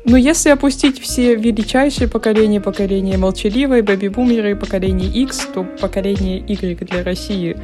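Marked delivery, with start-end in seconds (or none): none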